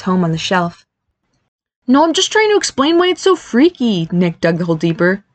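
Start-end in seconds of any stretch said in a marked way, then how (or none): 1.9-4.1 high-pitched, insistent protest; defensive younger-sounding brother, pitched up; defensive explaining. Acted. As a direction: justify yourself, pitch pushed up high.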